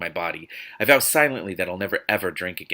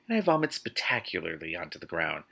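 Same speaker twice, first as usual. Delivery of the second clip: ordinary talk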